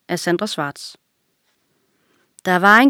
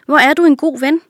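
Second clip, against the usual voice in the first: higher, louder grateful ostrich; glad; 7 semitones higher, 5 dB louder, faster than usual. A narrator's reading. Ostrich thanking the lion for a free day, glad. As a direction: gladly praise a dear friend. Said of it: "sagde strudsen glad".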